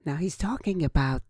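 normal talking voice

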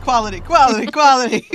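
excited. Real, not acted. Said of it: "raising the excitement".

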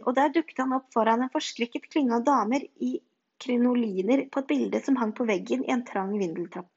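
usual speaking voice

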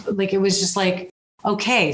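normal talking voice

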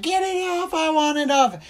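very high, frantic hammed-up plea; mock panicked girl. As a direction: shriek it out like a terrified girl. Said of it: "She's like".

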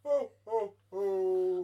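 put-on Father Christmas entrance call; Santa entrance. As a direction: belt out a jolly Santa's ho-ho-ho. Santa special guest enters.

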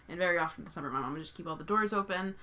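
ordinary talk, nothing marked

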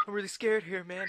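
high-pitched mock fright; acting scared. Retelling frightened customer's line, high mock fear. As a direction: play scared in a high cartoon voice. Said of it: "the guys are like".

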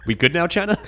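high, loud teasing jab; mocking taunt. Real, not acted. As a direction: toss it off as a cheeky dig. Taunting China after quote.

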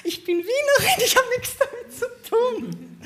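viel höher und lauter, halb lachend, halb weinerlich; erschrockene Rechtfertigung. Retold or imitated. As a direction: quietsch die Ausrede erschrocken heraus. Frightened protest at shouting jogger. (erschrocken vor dem Jogger, hoch, laut und lachend-weinerlich)

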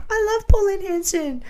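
high put-on mum voice, way up; mock the mum in a sugary high voice; mimicking mum praising a politician; mum impression. Mum voiced in retold story.

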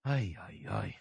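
low, soft muttered exasperation; quiet, pained lament at what was heard; weary dismay. Real, not acted. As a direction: mutter it wearily under your breath. Dismayed reaction to the played sermon.

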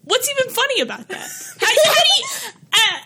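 high, squeaky disbelief, half-laughing, building to a yell; a hammed-up baffled shout about getting bullied for diabetes; mock incredulous. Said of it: "I was like".

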